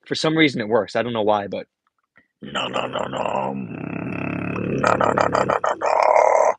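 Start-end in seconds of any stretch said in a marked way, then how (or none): 2.4-6.5 beatbox inward bass demo. Shown as a demonstration. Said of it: "those two I just showed".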